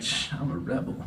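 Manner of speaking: hushed, conspiratorial aside; shush, then confide it very softly; whispered mock defiance; secretive whisper. External [name] secretly defies the order.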